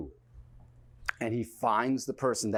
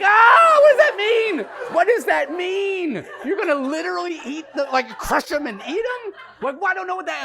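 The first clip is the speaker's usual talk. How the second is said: high, loud, bewildered yelling; incredulous shouting; 11 dB louder than usual. Retold or imitated. Onlooker reacts to threat to eat someone, incredulous shouting. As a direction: shriek the question in hammed-up disbelief.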